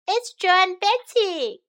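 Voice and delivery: put-on excited lion children calling. Lion cubs announcing the visitors, calling out. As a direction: call out like eager little cubs. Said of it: "called the little lion children".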